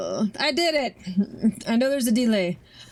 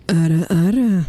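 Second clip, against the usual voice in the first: loud teasing ara ara; 7 dB louder than usual. Pretend. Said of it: "Is that better?".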